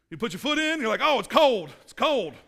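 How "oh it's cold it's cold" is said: loud, higher yelp at cold water; mock shivery protest; cold shock. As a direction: recoil from the chilly pool. Acting a swimmer shocked by cold.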